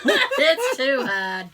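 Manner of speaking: loud, drawn-out words through laughter; laughing. Real, not acted. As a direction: squeeze it out slowly while laughing. Joking after heavy laughter.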